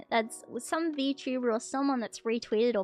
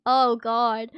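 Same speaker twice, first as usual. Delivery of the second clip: normal talking voice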